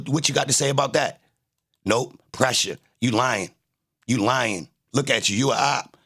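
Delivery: usual speaking voice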